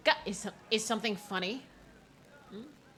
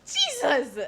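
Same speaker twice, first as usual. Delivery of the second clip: high, loud laughing exclamations; laughing shriek; 14 dB louder, much slower than usual. Real, not acted. Streamer flustered by the flirting, shrieking with laughter.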